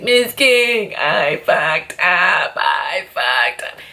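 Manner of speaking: slow, drawn-out whiny apology; hammed-up whining boyfriend; mock whine. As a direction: imitate his pathetic slow whimper. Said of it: "started whining again".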